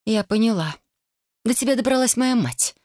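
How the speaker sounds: high, sudden outcry; cried out. A narrator's reading. Megan accusing Caleb about her mother, high outcry. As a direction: blurt it out as a realisation. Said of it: "выкрикнула".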